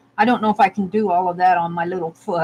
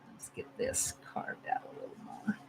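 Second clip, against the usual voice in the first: very soft whisper to yourself; hushed, barely voiced self-talk; absorbed murmur; 22 dB quieter than usual. Real, not acted. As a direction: murmur under your breath while concentrating on carving. Murmuring to self while carving.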